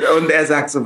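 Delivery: ordinary delivery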